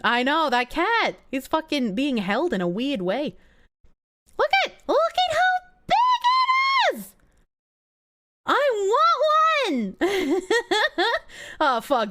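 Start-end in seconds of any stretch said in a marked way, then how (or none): 4.4-7.1 delighted squeal. Real, not acted. To chat over a giant cat, high squeal. 8.4-10.9 excited want. Real, not acted. Longing for a cat.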